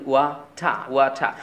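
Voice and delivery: ওয়াটা ending in open "tah", no r; British silent r. Shown as a demonstration. Teacher telling learners how to say water, ওয়াটা without r. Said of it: "র সাউন্ডটি silent হয়ে যায়".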